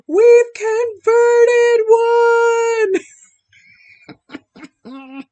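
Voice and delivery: high, loud, slow sung cry of victory; mock triumphant cheer. UK friends cheering her conversion to tea, sung loud. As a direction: belt it like a celebration. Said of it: "jumping up and down going".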